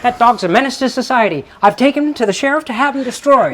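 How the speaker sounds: high, loud, rapid-fire movie villainess; Margaret Hamilton impression. Woman showing off her old-film villainess to a friend, high and loud. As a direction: spit out the accusation fast and piercing. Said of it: "Really good Margaret Hamilton".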